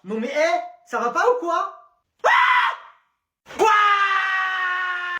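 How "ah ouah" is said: high, loud startled yell; outraged shout. Acted. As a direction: cry out as the snow hits. Shouting at throwers.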